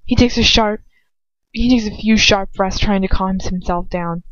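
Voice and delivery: ordinary delivery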